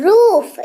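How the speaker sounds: "रूफ" slow, oo stretched out; stressed long oo. Shown as a demonstration. Teacher repeating "रूफ" for students, oo drawn out. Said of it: "जोर डाल रहे हैं".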